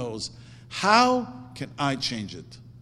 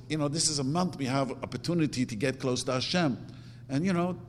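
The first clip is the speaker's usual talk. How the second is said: usual speaking voice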